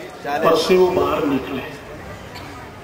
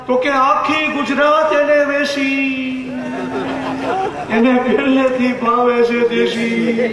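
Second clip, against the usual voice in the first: lilting, half-sung comic verse, pitched up; satirical poem recital; 5 semitones higher than usual. Acted. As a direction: recite it like a mocking folk song to the crowd. (વ્યંગ્ય કાવ્યનું લયબદ્ધ પઠન)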